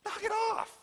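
high-pitched, exasperated scolding; urgent, insistent telling-off with the voice pushed up; stern Jesus. Acted. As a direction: scold them into calming down. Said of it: "Jesus is the guy that says, "Hey".

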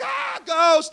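high, loud startled cry; mock scared yell. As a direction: yelp like you've seen a ghost. Disciples scared by ghost.

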